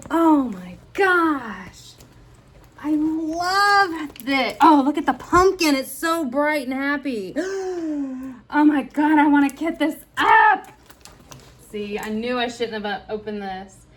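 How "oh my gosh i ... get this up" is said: high, loud bursts of delight; thrilled gushing. Real, not acted. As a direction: gush with excited gasps. Thrilled by the canvas.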